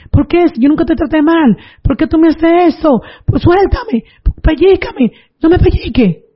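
high-pitched imitated screams at normal volume; retold screaming. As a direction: plead like grandma screaming to be let go. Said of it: "comenzó a gritar duro". (gritos agudos imitados, sin mucho volumen)

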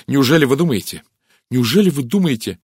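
high, loud disbelief; sharp, alarmed question. A narrator's reading. Rosamund suspects Poirot suspects her, sharp and high. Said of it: "резко спросила она".

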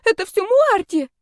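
high, panicky squeal; desperate pleading. A narrator's reading. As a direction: shift the blame in a terrified squeak. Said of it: "взмолилась девица".

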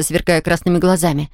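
ordinary delivery

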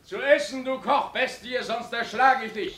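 roughly ordering a meal; angry demand. Acted. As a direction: threaten him for food. Commander demands food from cook.

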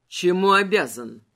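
imperious low voice; skeleton voice. Acted. King questions Adel coldly. (низкий холодный голос короля)

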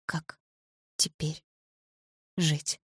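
very soft, broken word by word; numb echo. A narrator's reading. Sasha's words piercing Polina's heart, numb soft echo. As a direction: let each word fall softly alone.

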